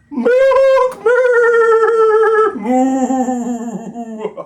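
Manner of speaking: squeaky high mooing, hammed up; mock the big bellies with a silly cow voice. Mocking the rivals' bellies with a silly high cow moo.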